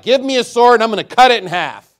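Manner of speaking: loud, high-pitched order; dead-serious command. Acted. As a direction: call out the order as the king in earnest. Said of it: "He must have been dead serious".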